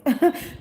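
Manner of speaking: quick high burst of laughter; short laugh. Real, not acted. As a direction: let a laugh pop out. Laughs after yes yes.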